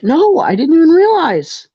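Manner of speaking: high-pitched, surprised protest; startled denial. Acted. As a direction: protest with your pitch shooting up. Acted tiefling's surprised denial.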